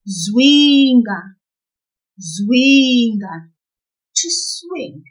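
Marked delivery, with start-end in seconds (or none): 0.1-3.5 loud Zwinga with a long drawn-out "Zwi"; pulled syllable. Shown as a demonstration. Teacher modelling for learners to repeat, Zwinga loud with "Zwi" pulled long. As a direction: hold the first syllable of Zwinga, projecting it clearly. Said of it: "Always pull the syllable before the last one".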